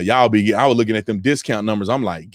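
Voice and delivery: ordinary talk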